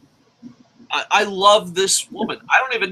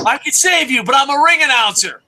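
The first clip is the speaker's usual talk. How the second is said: mock doctor; 7 semitones higher, 4 dB louder than usual. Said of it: "the doctor's like".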